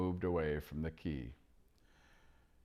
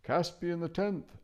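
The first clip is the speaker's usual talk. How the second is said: high hooting owl voice; 12 semitones higher than usual. Acted. Owl naming the king to Eustace. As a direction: answer as a calm owl.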